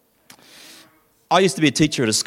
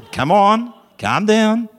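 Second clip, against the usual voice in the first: a touch of a gentle mum, pitched higher; calm coaxing; 8 semitones higher, 4 dB louder, slower than usual. Retold or imitated. Mother calmly asks her children what's wrong, lightly voiced. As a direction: coax the kids down patiently. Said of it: "she doesn't say".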